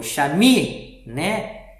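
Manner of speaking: "chaminé" with MI wrongly punched, "né" weak; stress on middle syllable. Shown as a demonstration. Teacher showing another wrong reading, stressed middle syllable. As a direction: hit the "mi" of "chaminé". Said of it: "acento na última sílaba".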